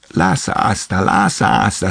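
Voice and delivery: plain reading voice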